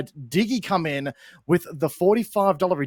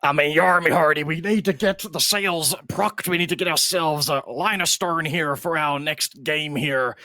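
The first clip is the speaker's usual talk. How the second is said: silly pirate voice. Pretend. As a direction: play a pirate captain hosting. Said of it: "put on our silly voices".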